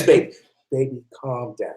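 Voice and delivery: calm him down gently, nearly a hush; soothing hush. Retold or imitated. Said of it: "She says".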